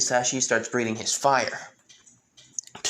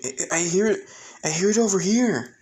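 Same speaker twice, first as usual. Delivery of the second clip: excited; 8 semitones higher, 4 dB louder than usual. Acted. Boy excitedly follows voice.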